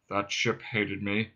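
low, slow robot drone; dejected. Acted. Marvin indicates the police craft, dejectedly. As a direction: let it sink in glum monotone. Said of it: "he said dejectedly".

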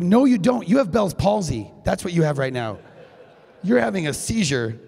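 mock exasperated retort. Retorting to the husband's denial.

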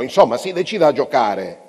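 loud, exasperated outburst, pitch up; losing control. A narrator's reading. Chess player losing control at his opponent, loud exasperated outburst. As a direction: snap at him, patience gone. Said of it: "perdette il controllo".

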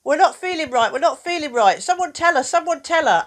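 a worried crowd of feelings pushing someone forward; fretting feelings. Pretend. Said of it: "have said".

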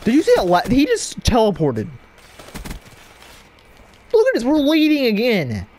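hyped at the lead; excited gamer. Real, not acted. Excited at the game.

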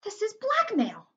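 high, loud, slow protest; shocked boy crying out; indignant outburst. A narrator's reading. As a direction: exclaim in shocked outrage. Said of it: "said George".

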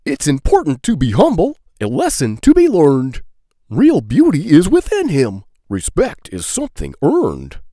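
plain reading voice

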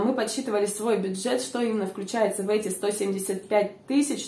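normal talking voice

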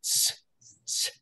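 "s" said as an isolated TS sound; "s" as a bare hissed consonant, repeated after a pause; TS sound demo. Shown as a demonstration. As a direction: demonstrate "s" as the letter sound TS, not its name. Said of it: "rendered as a T S".

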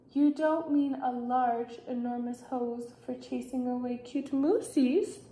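soft, level, wary question; hesitant question. A narrator's reading. Luke asking Dad about the enormous hose, hushed and wary. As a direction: ask it quietly, as if dreading the answer.

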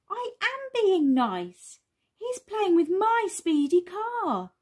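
high, slow, emphatic whine of objection; high-pitched defensive little kid; indignant child protest. Acted. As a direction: protest as a put-upon small child. Bing protests when told off.

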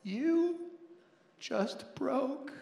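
mock heartbroken child. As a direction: say it like a wounded four-year-old, half laughing. Daughter heartbroken over waffles.

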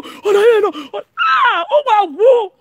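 loud, high-pitched wailing exclamations; hammed-up high wail; mock outcry. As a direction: cry out in exaggerated, squealing dismay.

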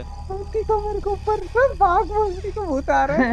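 panicked. Real, not acted. Ghost spotted in horror game.

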